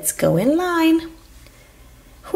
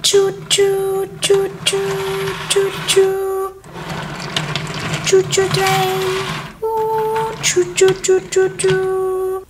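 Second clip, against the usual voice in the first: high, drawn-out choo-choo; train whistle; 4 semitones higher, slower than usual. Pretend. Conductor playing choo-choo train for the viewers, whistle imitation. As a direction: imitate a train whistle on one held note. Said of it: "the conductor of this choo-choo train".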